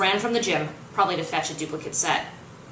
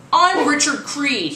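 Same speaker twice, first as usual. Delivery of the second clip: slow, higher, louder self-important introduction; smug coach intro; 7 semitones higher, 6 dB louder, slower than usual. Acted. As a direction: announce yourself like a cocky showman. Coach introduces himself on video.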